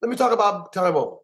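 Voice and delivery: high emphatic no, then rushing on; eager interruption. Retold or imitated. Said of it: "It's like".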